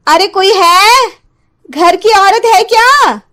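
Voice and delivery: high, slow calling out at the door; a stranger calling into the house, high and drawn out; witch calling sweetly. Acted. Disguised witch calls at door. (ऊँची, धीमी आवाज़ में पुकारती औरत)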